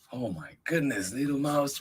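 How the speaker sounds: plain reading voice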